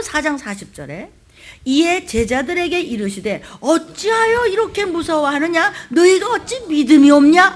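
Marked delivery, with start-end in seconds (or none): 3.6-7.5 loud scolding, voice rising; growing rebuke. Acted. Jesus scolding the disciples more sharply, loud. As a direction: scold even harder. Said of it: "야단치는 게 커지시네".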